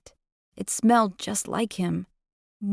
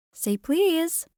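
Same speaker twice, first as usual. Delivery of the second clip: sweetly teasing; 9 semitones higher than usual. A narrator's reading. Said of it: "I smiled up at him sweetly".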